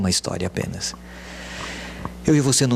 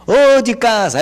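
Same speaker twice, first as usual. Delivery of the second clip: high, loud call to the house; 12 semitones higher, 8 dB louder, slower than usual. Shown as a demonstration. Visitor calling into the house, loud. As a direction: call across the yard. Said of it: "A gente não fala assim?".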